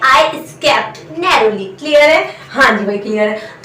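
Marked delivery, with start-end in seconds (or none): 0.1-1.7 slow model sentence. Shown as a demonstration. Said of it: "आप इसे English में बोलेंगे".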